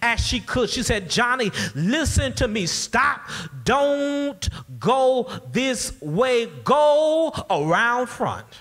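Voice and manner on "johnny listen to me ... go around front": high, very slow, emphatic command; imitating a lady yelling a last warning; firm mock yell. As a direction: stretch each word out as a firm order. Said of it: "the lady yelled at the top of her lungs and as firmly as she could".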